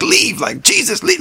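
re-enacted high-pitched cry to drive spirits out; desperate command. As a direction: call it out urgently, pitch shooting up.

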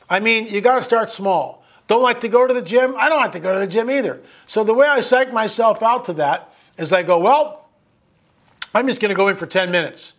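ordinary talk

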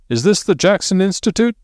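high-pitched cheery enquiry. A narrator's reading. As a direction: call the question cheerily through a door. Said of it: "said a cheery voice outside".

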